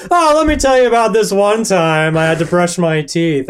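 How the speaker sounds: imitating a kid on Snapchat, bragging; mock bragging kid. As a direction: play a kid who thinks they're a star. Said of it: "they'll be like".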